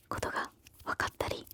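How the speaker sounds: normal talking voice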